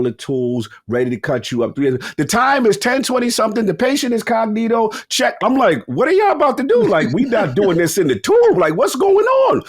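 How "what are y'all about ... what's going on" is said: high-pitched comic disbelief; alarmed protest. Retold or imitated. Patient seeing surgeon ready to cut, comically alarmed. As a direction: demand answers in a rising, panicky pitch.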